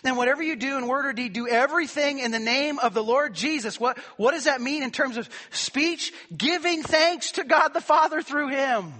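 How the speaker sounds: high, loud scripture proclaimed with force; fervent preaching. Acted. Pastor proclaiming Colossians to his congregation, loud fervent preaching. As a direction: proclaim the verse to the whole church.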